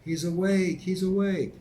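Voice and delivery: high, loud frantic scream. Acted. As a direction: shriek it out in alarm. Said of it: "started screaming".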